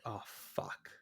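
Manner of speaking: hushed, breathy "oh, fuck" under the breath; whispered curse. Retold or imitated. Podcaster quoting the boardroom men, whispered dismay. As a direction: let it slip out as a stunned whisper.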